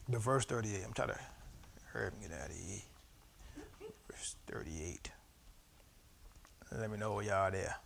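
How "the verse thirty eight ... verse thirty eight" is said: low, soft murmur, trailing almost into a whisper; absent-minded and very quiet; muttering to self. Real, not acted. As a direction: mumble it under your breath while finding the page. Finding the verse, killing time.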